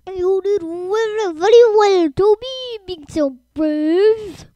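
loud, hearty official congratulating; booming praise. A narrator's reading. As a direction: proclaim the praise very loudly, stretching the end. Sir Topham Hatt praises Toby publicly.

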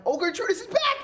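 high excited cheer. Real, not acted. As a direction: cheer him back in. Cheering an ally's return.

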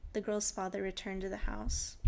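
ordinary delivery, nothing marked

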